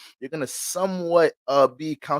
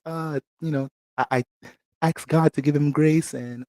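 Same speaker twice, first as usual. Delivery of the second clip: Mike's soft voice; 3 semitones higher than usual. Retold or imitated. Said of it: "get your soft-spoken voice ass".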